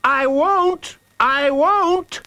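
put-on high-pitched child's refusal; defiant child. Jacob refuses to scream for Hooded Fang, defiant.

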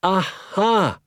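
high, loud cry of realisation; excited cry. A narrator's reading. Listeners grasping the doctor's explanation, excited cry. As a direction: burst out as the penny drops. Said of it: "we cried".

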